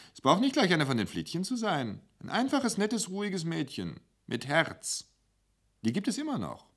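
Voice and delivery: a kindly waitress fussing over him; motherly waitress. Acted. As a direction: sorgende Kellnerin, höher und schnell. Wulla urging a nice girl.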